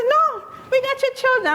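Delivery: high, emphatic no; insistent good news. Retold or imitated. Detective telling mother her children are found, high and emphatic. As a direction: push the news through her disbelief.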